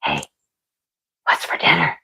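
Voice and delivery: whispered wake-up call. Pretend. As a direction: whisper softly to rouse a sleeper. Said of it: "wake the sleeping beauty and be like".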